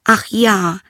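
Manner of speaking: high eager princess. A narrator's reading. Princess offering the frog anything, high and eager. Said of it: "sagte sie".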